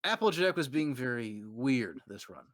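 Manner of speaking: plain reading voice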